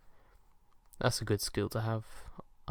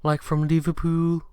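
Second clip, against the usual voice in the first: higher, louder mock Liverpool accent; 6 semitones higher, 5 dB louder than usual. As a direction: put on a Scouse accent. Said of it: "a lot of terrible accents".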